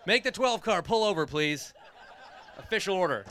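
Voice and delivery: mayoral order. Pretend. Mayor jokingly orders a driver over the radio, mock official. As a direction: issue a playful order as mayor. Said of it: "making mayoral orders over the radio".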